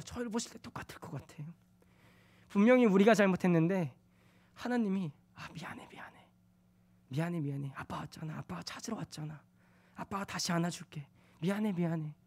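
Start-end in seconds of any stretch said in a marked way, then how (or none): 5.3-12.1 soft, hushed whisper of comfort; a father gently soothing his child; tender apology. Pretend. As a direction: whisper sorry like rocking a crying child.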